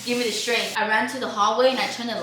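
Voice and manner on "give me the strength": scared prayer. Retold or imitated. Praying for courage.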